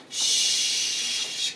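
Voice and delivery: "sh-," as a long, drawn-out hush; SH sound. Shown as a demonstration. Teacher shows S becoming SH, long sustained SH. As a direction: hold the "sh-," as one slow hiss. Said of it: "it's an S H".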